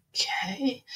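softly questioning, rising okay; wary acceptance. Retold or imitated. Teller replays her reply to her head of copy's warning, wary and hushed. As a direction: go along with it, quietly unconvinced. Reluctant reply to colleague's threat.

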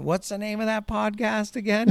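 high-pitched imitation of a dad; dad piping up. Dad asks the podcaster for the show's name, high mock voice. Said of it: "Dad's over there going".

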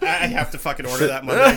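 ordinary talk, nothing marked

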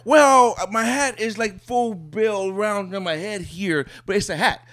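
high, mocking voice of someone dodging; mock rambling answerer. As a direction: lampoon a rambling non-answer. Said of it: "That's like".

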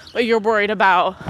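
ordinary talk, nothing marked

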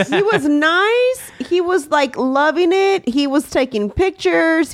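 excited. Real, not acted. Praising grandpa's good mood.

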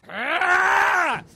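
high, very loud attack yell; battle scream. Retold or imitated. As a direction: scream like leaping onto a foe. Imitates Logan leaping down to attack.

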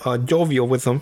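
normal talking voice

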